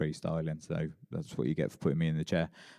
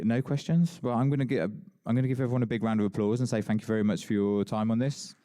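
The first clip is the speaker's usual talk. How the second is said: rousing applause call; 6 semitones higher, 4 dB louder than usual. Real, not acted. Host calling for applause.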